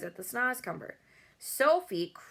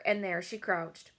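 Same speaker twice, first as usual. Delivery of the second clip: ordinary delivery, nothing marked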